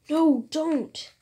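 hero's pretend protest; mock cry of protest. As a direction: cry out, lightly acted. Hero cries out in comic.

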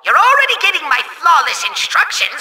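ordinary talk, nothing marked

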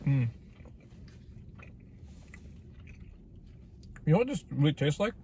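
chewing while talking; speaking between bites; mouth full. Real, not acted. Seen 2: Bite at -4.0; closed, working mouth chewing through early words.

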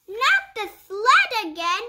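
lively picture-book read-aloud; reading to children. Acted. Reading a puppy picture book to young viewers, bright storytime voice. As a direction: read it like storytime for little kids.

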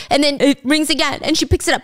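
ordinary talk

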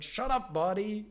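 loud, high snap at her own body; mock angry snap. Meditation teacher snapping at her own upset body, loud and high. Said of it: "and I'll be like".

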